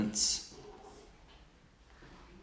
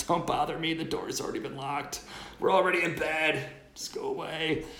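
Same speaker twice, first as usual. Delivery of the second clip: whiny friend from bed; 7 semitones higher than usual. Retold or imitated. Friend in bed voiced, inner dialogue.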